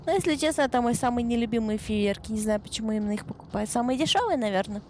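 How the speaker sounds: usual speaking voice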